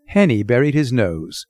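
plain reading voice

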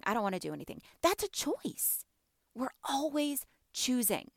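usual speaking voice

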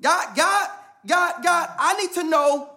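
high, loud, urgent calling out to God; pleading prayer. Acted. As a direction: cry out for an answer. Acted prayer crying out to God.

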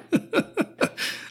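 high airy laugh breaking down; breathless choked laugh. Real, not acted. Host breaking down laughing after voicing Peter. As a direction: laugh through tears. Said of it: "I can't even fucking talk about this".

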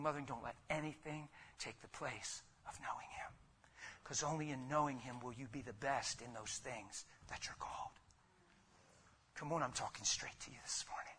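hushed whisper to the congregation; urgent whispered appeal. Real, not acted. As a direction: drop to an intimate whisper.

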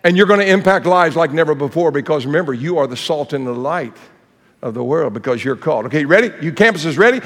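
normal talking voice

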